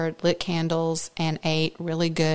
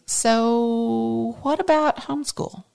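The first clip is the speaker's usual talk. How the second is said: slow, drawn-out, higher voice for husband; husband's suggestion; 4 semitones higher, slower than usual. Retold or imitated. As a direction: float a tentative idea, stretched out. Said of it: "my husband said to me".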